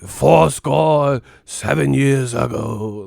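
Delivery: loud, slow Gettysburg-style oration; hammed-up historical narrator; mock old narrator. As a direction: orate it like a solemn old speech. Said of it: "old narrator sounding".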